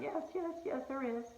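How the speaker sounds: high, breaking tearful agreement; imitating a woman's tearful yes. Retelling the crying woman's answer, high and tearful. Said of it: "and she's crying".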